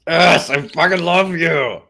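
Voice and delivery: loud, slow emphatic declaration; excited. Real, not acted. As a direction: gush it out with a big burst of love. Emphatic burst of affection.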